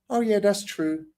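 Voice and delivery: high, airy put-on female voice; light high voice, easily won over; mock agreeing girlfriend. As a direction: imitate her cooing agreement. Imitates girlfriend agreeing with video.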